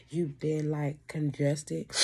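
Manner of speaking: ordinary delivery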